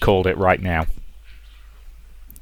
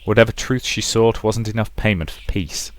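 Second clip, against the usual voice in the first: usual speaking voice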